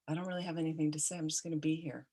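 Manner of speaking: gently understated and quiet; soft reassurance. Pretend. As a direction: say it softly, just being present. Models sitting quietly with the dying.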